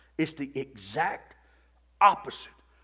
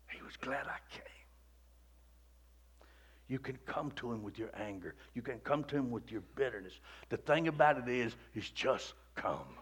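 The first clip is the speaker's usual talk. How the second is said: hushed appeal; 7 dB quieter than usual. Acted. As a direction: draw them in close and quiet. Drops to hushed intimate appeal.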